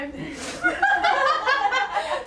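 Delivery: high, loud peal of laughter; real giggling fit; laughing. Real, not acted. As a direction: laugh out helplessly. Medium laughs at spirit's remark.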